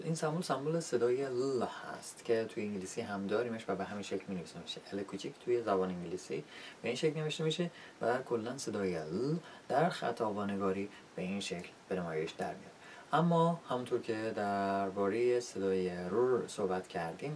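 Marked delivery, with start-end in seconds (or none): none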